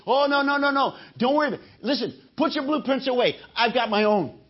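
high-pitched, dismissive and boastful; cocky know-it-all. Retold or imitated. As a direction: brush them off like a show-off. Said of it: "and I say".

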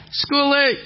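high, loud holler; big bellowed call; shouted name. Retold or imitated. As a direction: holler the name across a busy workshop. Man bellows name across shop.

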